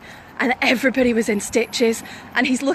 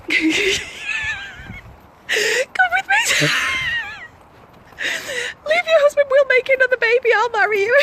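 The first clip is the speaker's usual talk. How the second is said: helpless high squealing laughter while quoting; laughing through the words. Real, not acted. Retelling a stranger's absurd proposal, laughing helplessly. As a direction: barely get the quote out for laughing.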